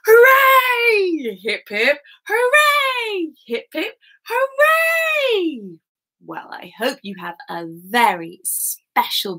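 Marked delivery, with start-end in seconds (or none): 0.1-5.8 high, loud cheer; hooray cheer. Shown as a demonstration. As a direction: cheer it out big for the birthday. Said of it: "give her a big hip hip hooray".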